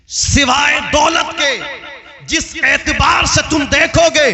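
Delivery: impassioned oratory pressing on without let-up; full-force preaching. Real, not acted. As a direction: preach in insistent, rhythmic phrases.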